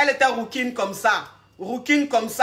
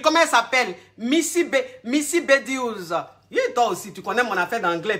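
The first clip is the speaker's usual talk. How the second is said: usual speaking voice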